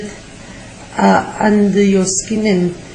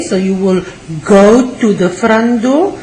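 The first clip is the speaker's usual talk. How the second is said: ordinary delivery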